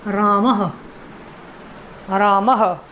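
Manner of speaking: रामः said with a breathy h after the a, "raamah"; visarga pronunciation. Shown as a demonstration. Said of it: "अब हम इसको बोलेंगे".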